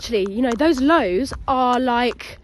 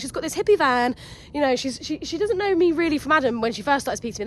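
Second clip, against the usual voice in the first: ordinary talk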